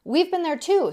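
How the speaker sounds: angry snap, high and loud; snapping. A narrator's reading. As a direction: snap back indignantly. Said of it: "snapped Jill".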